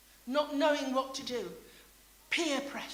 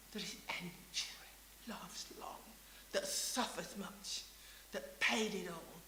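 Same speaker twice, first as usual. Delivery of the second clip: hushed, breathless whisper; sudden whisper; 6 semitones lower, 12 dB quieter than usual. Acted. Preacher to congregation, whispering of God's love. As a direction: drop to a whisper so they lean in.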